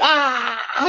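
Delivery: high drawn-out "aah" of frustration; mock outburst cry. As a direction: act out wanting to blow up, without loudness. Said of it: "saya pengen emosi gitu". (teriakan kesal pura-pura)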